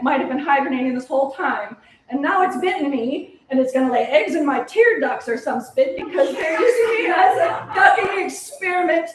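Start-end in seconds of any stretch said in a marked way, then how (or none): 2.1-9.1 panicked shouting. Acted. Panic over mosquito eggs.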